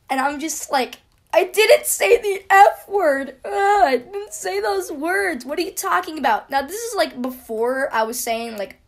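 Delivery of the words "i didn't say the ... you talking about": drawn-out, high wailing protest; mock crying. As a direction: retell it as a loud, tearful whine. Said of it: "I'm just crying there, like, with tears".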